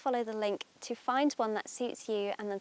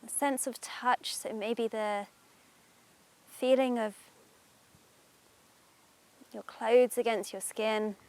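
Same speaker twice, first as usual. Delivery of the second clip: guided meditation; 3 dB louder than usual. Acted. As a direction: ease them into calm, unhurried.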